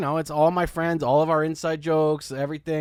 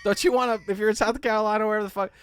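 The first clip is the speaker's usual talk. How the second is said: excited sales pitch; 7 semitones higher than usual. Acted. Hammy mug sales pitch.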